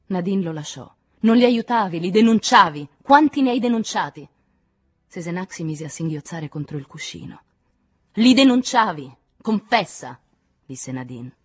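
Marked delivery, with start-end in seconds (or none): 1.2-4.3 loud, high, forceful; shouted interrogation; furious accusation. A narrator's reading. Angry contradiction in interrogation. 8.1-10.2 loud, high-pitched demand; shouting accuser; furious accusation. A narrator's reading.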